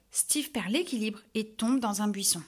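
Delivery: ordinary delivery, nothing marked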